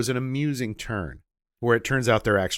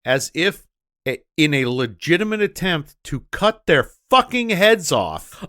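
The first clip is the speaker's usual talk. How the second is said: heated disbelief; 6 semitones higher, 7 dB louder than usual. Real, not acted.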